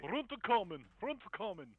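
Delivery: high, loud repeated shout; calling out. Acted. Player answers joke about Mog speaking common, shouting. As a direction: holler it as an urgent call.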